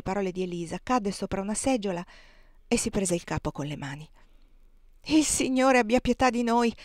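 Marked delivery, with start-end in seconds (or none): 5.1-6.7 a louder, higher cry to the Lord; aunt Clo crying out at the news; anguished exclamation. Acted. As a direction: cry out for mercy in dismay. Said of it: "esclamò la zia Clo".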